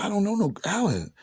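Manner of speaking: high-pitched mock protest; nervous denial. Retelling his denial to Alan about the girls, nervous denial.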